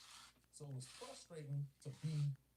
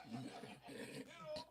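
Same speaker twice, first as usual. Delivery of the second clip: usual speaking voice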